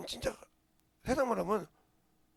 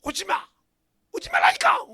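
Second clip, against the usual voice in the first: loud, soaring much higher on the second cry; retold shout; 10 dB louder than usual. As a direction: shout it, then push it even higher. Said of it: "소리 내서 했거든요". (점점 높아지는 외침)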